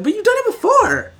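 high-pitched customer complaint; indignant protest. Retold or imitated. Customer objecting to a refused order, indignant high-pitched protest.

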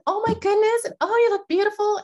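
high, loud, gushing salesperson greeting; bubbly saleswoman voice. Retold or imitated. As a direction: gush like the top Nordstrom salesgirl. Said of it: "she always talked like this".

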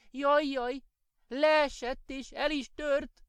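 high, slow little elf wail over the broken egg; high lamenting elf voice. A narrator's reading. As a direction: cry out in dismay, drawn out. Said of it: "sopánkodott Manócska".

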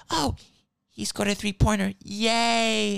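high, dainty mock cheer; energy-free commentator. Mocking women commentators who lack angry energy, feeble high cheer. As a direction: imitate a feeble, too-nice sports call. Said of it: "You need energy, not".

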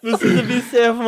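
laughing. Real, not acted. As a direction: try to read while laughing. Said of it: "vocês me desconcentraram".

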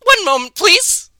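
prim phone operator putting caller on hold; high receptionist. Acted. Co-host voices receptionist.

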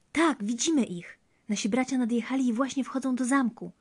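high, loud jubilant news; excited shouting back. A narrator's reading. Sisters see brothers entering the castle, shouting back. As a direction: cry out the sighting with relief. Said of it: "odkrzyknęły siostry".

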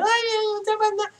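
very high thin squeaky voice; thin reedy voice. Retold or imitated. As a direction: squeak it out like a little girl. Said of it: "fala fininho".

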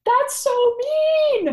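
high, loud, drawn-out outrage; outraged. Real, not acted. Listener reacting to Jill mocking Mark, outraged. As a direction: cry out at the injustice, stretching it.

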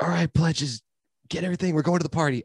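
put-on high-pitched frat-boy announcement; mock rallying call. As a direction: imitate someone rounding up the pledges. Voices frat guys rounding up pledges.

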